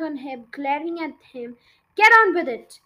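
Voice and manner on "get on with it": high, much louder angry shout. A narrator's reading. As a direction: roar at the stalling boy. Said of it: "she shouted".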